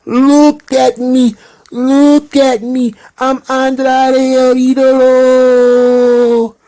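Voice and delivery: high, slow, strutting boastful voice; Andrade impression. Andrade boasting to Keith Lee, high and slow. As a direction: preen and show off, drawn out and high. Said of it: "Can you do an impression ... of Andrade".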